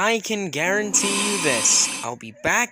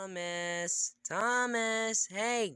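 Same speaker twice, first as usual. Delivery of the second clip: very soft, higher-pitched coaxing; hushed wake-up call; 4 semitones higher, 11 dB quieter than usual. Acted. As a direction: softly nudge a sleeper awake. Gently waking a sleeping friend.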